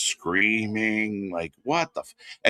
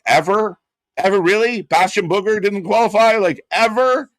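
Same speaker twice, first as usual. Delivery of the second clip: incredulous mocking echo; 10 semitones higher, 4 dB louder than usual. Mocking a fan's overreaction.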